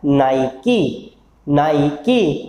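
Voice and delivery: "नाइकी" slowly, with the final kee sounded; correct pronunciation. Shown as a demonstration. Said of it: "correct pronunciation क्या होता है?". ("नाइकी" धीरे, आख़िर में साफ़ की)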